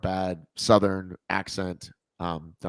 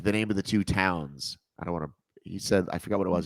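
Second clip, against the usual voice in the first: ordinary talk, nothing marked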